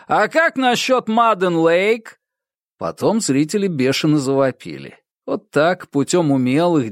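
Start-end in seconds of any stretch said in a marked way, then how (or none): 0.1-2.2 high, drawn-out announcer's call; rabble-rousing DJ. A narrator's reading. DJ suggests another band to the audience, rousing. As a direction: stretch the band name to rouse the crowd. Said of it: "Он вышел на сцену и сказал".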